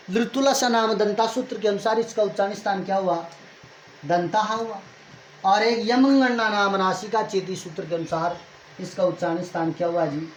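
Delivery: ordinary delivery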